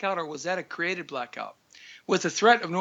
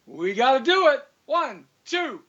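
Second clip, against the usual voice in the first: excited count-in; 7 semitones higher, 3 dB louder, slower than usual. Real, not acted. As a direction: count in higher and higher, last beat loud. Counting in the music.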